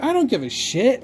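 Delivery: not caring about the rating, scoffing; defiant retort. Retold or imitated. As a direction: toss it back, defiant. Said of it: "And I'm like".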